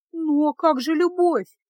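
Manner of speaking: high small slow squeak; a timid little squeak of a question; пискнула. Acted. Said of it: "пискнула Феска". (тоненько, робко пискнуть)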